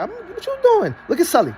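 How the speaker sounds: high, startled puppy voice; Meatball's reaction. Retold or imitated. Voicing Meatball facing Sully, high and startled. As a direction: squeak it out like a shocked pup. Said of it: "Meatball was like".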